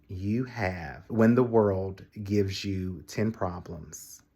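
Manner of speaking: soft, slow and deliberate; measured, weighty quiet wisdom; slow quotable line. Real, not acted. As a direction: deliver it like a book's epigraph. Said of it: "chapter of a future book".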